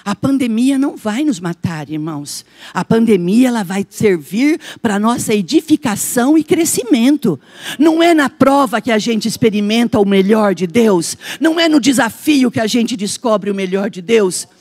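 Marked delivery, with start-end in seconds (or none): 2.7-14.5 loud, declaiming sermon voice; forceful preaching. Acted. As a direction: proclaim it to the congregation. (pregação em voz alta)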